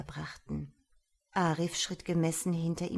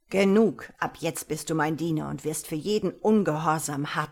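loud, harsh wizard order; harsh command; 7 dB louder than usual. A narrator's reading. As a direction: bark the threat coldly. Wizard seizes the boy.

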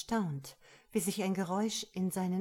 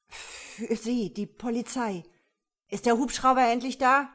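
louder, higher impatient demand; bank robber on phone; 4 semitones higher, 8 dB louder than usual. A narrator's reading. Robber to police over the phone, impatient.

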